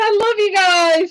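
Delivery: real tears catching in the voice, high; welling up. Real, not acted. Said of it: "Oh, now I am gonna cry".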